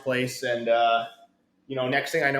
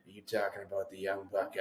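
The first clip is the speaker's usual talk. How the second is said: low gruff coach; 7 semitones lower, 12 dB quieter than usual. Retold or imitated. Voicing Mac T.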